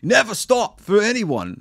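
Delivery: high, loud mock threatening drifter; hammed-up menacing bushman; menacing outback killer. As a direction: jeer it at the tourists like the killer. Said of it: "at one point he says".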